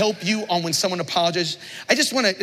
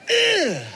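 groan of disgust; 11 semitones higher than usual. Real, not acted. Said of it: "disgust at non-apology".

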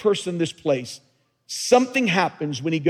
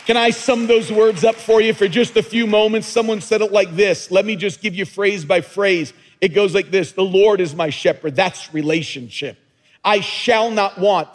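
higher and louder, building with each phrase; preaching at full force; 5 semitones higher, 4 dB louder than usual. Acted. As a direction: climb into a rousing preacher's call.

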